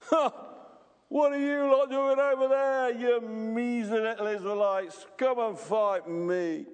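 high, loud bellow across a valley; Goliath mocking at full volume; taunting giant's shout. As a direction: roar a drawn-out challenge at the enemy ranks. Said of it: "he's shouting to the ranks of Israel".

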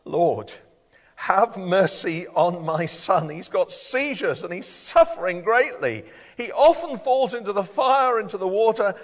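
loud, high, desperate plea; agonized pleading. Acted. A desperate father begs the Lord for his son, agonized. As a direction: beg in anguish, stretching the words out. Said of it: "you can hear the agony in his voice".

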